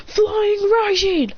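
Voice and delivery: very slow, high shouted move name; high drawn-out anime shout; high slow attack call. Acted. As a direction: stretch out the attack call from above. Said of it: "he appears above her going".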